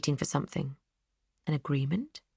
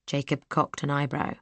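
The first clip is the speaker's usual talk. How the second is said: ordinary delivery, nothing marked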